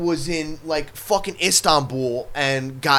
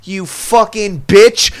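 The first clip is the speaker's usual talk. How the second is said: loud, higher hammed-up sneer; hammed-up sarcastic pity; sarcastic mock pity; 8 semitones higher, 8 dB louder than usual. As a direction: sneer at the spoiled complainer, loud and high.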